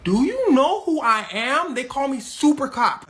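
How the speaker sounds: loud, high, drawn-out boast; grandiose cop. Retold or imitated. Super Cop answers Hush Puppy's call, grandly boasting. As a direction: announce yourself like a mock superhero.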